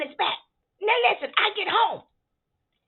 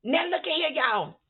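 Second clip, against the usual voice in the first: ordinary delivery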